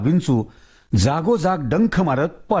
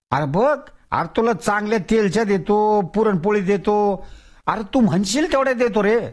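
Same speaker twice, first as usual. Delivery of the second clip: higher, coaxing village elder; coaxing Tatya; 6 semitones higher than usual. Acted. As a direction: sweet-talk the stubborn ghost. Tatya bargaining with food.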